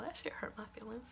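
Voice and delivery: whispered aside. Real, not acted. Confiding her hurt to viewers in a whisper. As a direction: breathe it out as a secret to the viewers.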